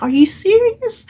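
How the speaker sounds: re-live the shock of a free gift; surprised disbelief. Retold or imitated. Free gift surprises her in shop.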